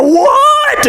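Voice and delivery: squealing high shouted what; freaked-out yell. Retold or imitated. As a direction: panic like a cartoon. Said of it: "he's freaking out".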